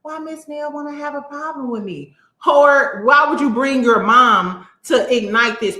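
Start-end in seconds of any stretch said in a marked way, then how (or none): 0.0-2.1 higher, softer whiny victim imitation; mock victim voice. Mocking Tisha playing the victim, whiny and soft. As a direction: whine it like the innocent victim. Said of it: "Remember, you the victim".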